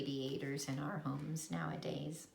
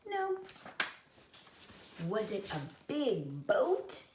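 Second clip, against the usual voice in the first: ordinary delivery, nothing marked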